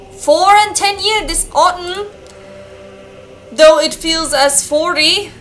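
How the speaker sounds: lightly acted old-world priest; solemn game-character read-out; village priest. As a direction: declaim it as an archaic village priest.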